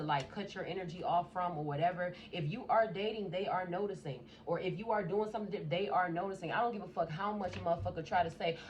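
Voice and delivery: ordinary talk, nothing marked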